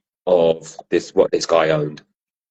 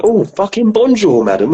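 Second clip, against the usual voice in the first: mocking passerby; 4 dB louder than usual. Passerby in Cork jeers at woman in a beret, mocking. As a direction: jeer at her in a high put-on voice.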